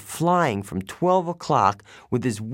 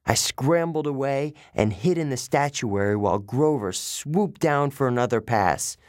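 ordinary delivery

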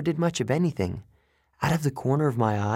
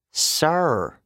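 put-on smooth, higher, British-accented prompt; smooth addition; 5 semitones higher, 3 dB louder than usual. A narrator's reading. Underworld guard corrects the boy's manners, smoothly. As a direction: slip it in smoothly, a little higher. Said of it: "he added smoothly".

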